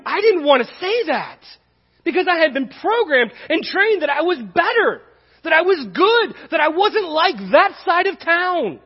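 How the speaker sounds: high, loud, driving preacher voice; impassioned sermon peak; preaching at full force. Real, not acted. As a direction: build it hard and loud. Preaching build on self-righteousness.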